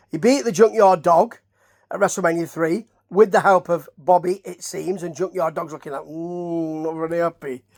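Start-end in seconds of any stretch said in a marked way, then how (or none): 6.0-7.6 lightly put-on Yorkshire grumble; mock Yorkshire accent. Voicing the displeased Junkyard Dog, mock Yorkshire accent. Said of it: "he's not from Yorkshire or anything".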